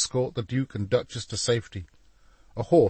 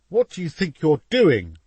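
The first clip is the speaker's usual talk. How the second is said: higher, louder indignant question; alarmed objection; 7 semitones higher, 7 dB louder than usual. A narrator's reading. Painter to boy shaking the scaffold, alarmed objection. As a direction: demand an explanation, voice raised.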